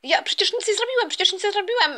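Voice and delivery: high-pitched defensive protest; protesting son. Acted. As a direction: plead innocence in a high voice. Scolded son protests innocence.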